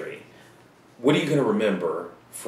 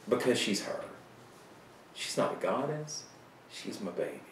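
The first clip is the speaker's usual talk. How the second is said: tender; 9 dB quieter than usual. Pretend. Tender turn after the rant.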